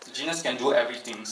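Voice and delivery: ordinary talk, nothing marked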